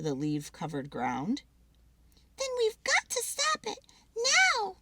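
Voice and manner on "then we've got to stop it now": high squirrel, louder on now; firm little squirrel demand; insisting squirrel. Acted. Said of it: "insisted Pip".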